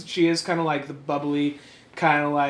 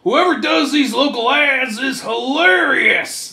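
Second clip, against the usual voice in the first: mock quote; 8 semitones higher, 6 dB louder, slower than usual. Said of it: "They're like".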